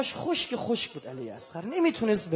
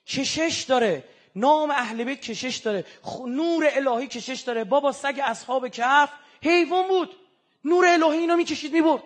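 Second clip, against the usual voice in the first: rising sermon voice; 6 semitones higher, 7 dB louder than usual. Acted. Preacher driving his point home to the congregation, rising. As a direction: preach it, climbing louder and higher.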